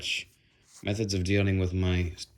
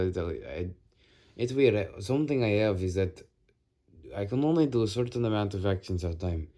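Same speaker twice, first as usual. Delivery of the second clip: normal talking voice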